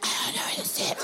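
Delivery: put-on voiceless, strained kid's answer; helpless whispered cry. Kid answering the bus driver, helpless strained whisper.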